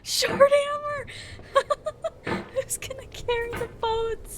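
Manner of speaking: high, wailing fake cry; mock crying. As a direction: whimper it in despair. Mock crying over tiny hammer.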